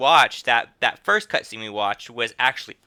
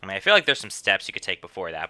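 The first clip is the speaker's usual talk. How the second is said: ordinary talk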